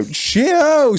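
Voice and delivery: high mock cheerio as they bolt; cheery farewell. Eight blokes fleeing the club, high chirpy cheerio. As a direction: sing it out while turning to leave.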